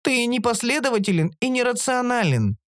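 high-pitched creature objection; creature objecting. A narrator's reading. Оша disputing the narrator's plea, objecting. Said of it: "снова возразила Оша".

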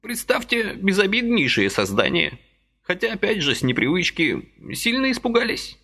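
put-on high fussy old man's voice, gently reproaching; a little old man chiding kindly; old man reproaching Vasily for his haste, high and fussy; reproachful old man. A narrator's reading.

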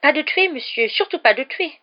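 high, loud shocked protest. A narrator's reading. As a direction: protest as a horrified elf. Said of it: "dit Dobby l'air choqué".

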